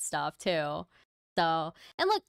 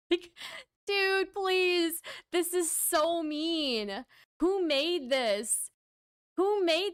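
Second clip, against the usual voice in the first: mock outrage; 8 semitones higher, much slower than usual. Real, not acted.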